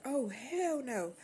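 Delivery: imitating mom's slow disbelief; mother scolding. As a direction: drag it out like an outraged mom. Mother confronting drunk teen.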